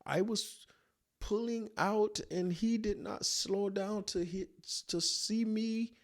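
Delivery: put-on high, slow African-accented excuse to the cop. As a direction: tell the cop your version in his accent. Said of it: "I hear him say to the cop".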